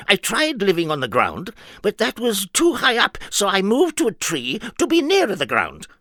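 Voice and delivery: high, earnest nonsense character; high silly voice. Acted. Mister Nonsense explaining himself, high and earnest. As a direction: say the daft logic perfectly seriously, up high.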